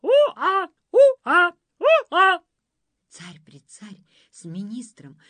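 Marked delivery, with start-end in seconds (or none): 0.0-2.4 put-on high, very loud baby-like bawling; loud cries. A narrator's reading. Prince cries out after the nanny, loud cries. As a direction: bawl it out in short bursts. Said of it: "громкие крики".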